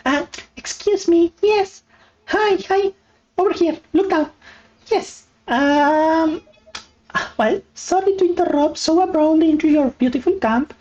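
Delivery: a tiny creature demanding attention; bunny witch voice. Acted. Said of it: "this bunny goes".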